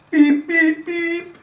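high loud beeping horn; honking truck. Acted. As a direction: honk like a happy truck. Truck honks at the end.

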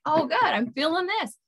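lightly voiced soul, welcoming any feeling; soul accepting. The soul taking fear as mere vibration, mildly cheerful. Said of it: "And it's like".

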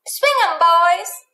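high calling cry to the dancers; cried out. Acted. Cindy calling out to the square dancers, high cheerful cry. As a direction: call it across the dance floor. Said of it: "cried Cindy".

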